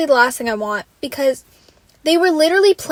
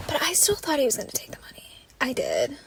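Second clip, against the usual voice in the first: softer confiding whisper; 10 dB quieter than usual. Real, not acted. Vlogger confessing her guess to viewers, whispered. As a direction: let them in on a secret.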